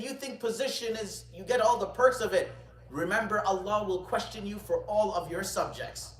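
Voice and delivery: loud, high-pitched challenge to the ruler; stern admonition. Retold or imitated. Scholar reminds the caliph of Allah's questioning, stern admonition. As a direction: drive the warning home forcefully.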